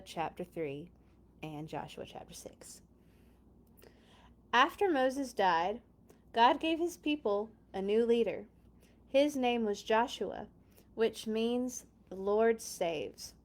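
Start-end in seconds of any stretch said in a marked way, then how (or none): none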